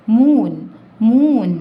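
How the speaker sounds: loud drill. Shown as a demonstration. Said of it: "loud drill of word".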